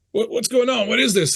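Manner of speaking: startled henchman, high-pitched questions; alarmed digger. Acted. Diggers back away from dark mist.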